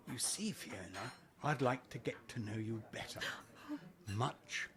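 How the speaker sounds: low, soft, slowing murmur; intimate seduction. Acted. Suitor accepting a nightcap invitation, softly seductive. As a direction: draw closer and let each word linger.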